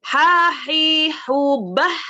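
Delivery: "Ha, hi, hu" from the middle of the throat, breathy and loud; raspy mid-throat "Ha"; teacher showing the mid-throat letter. Shown as a demonstration. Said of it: "suaranya tetap dalam tapi dia agak ke tengah".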